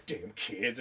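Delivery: low, soft grumble; grumbling old man. Retold or imitated. Ozzy scrubbing the wall, low grumble about kids. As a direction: mutter it under your breath like a crank.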